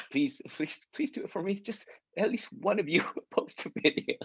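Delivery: high-pitched begging broken by real laughter; laughing while pleading for videos; laughing plea. Real, not acted. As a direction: beg them through giggles, pitch up high. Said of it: "Oh, God, I cracked myself".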